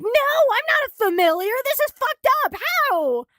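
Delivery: loud, high-pitched indignant outburst; hammed-up outrage, voice shooting up; outraged protest. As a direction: yell it in disbelief as a mock outraged character. Acting out outraged Caligo's objection.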